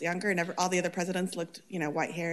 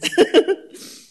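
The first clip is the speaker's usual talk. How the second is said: loud high laugh; real laugh, louder and higher; burst of laughter; 12 semitones higher, 16 dB louder than usual. Real, not acted. Councilwoman laughing at her own joke about her colleague, loud and high.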